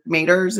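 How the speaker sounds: "maters" as MAY-terz, first syllable dropped; dad's Southern maters. Retold or imitated. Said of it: "my dad is infamous for saying".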